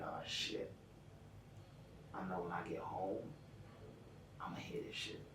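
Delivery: hushed, breathy inner grumble; dreading going home, a hushed mutter to himself; muttered to self. Retold or imitated. Imagined inner thought, muttered.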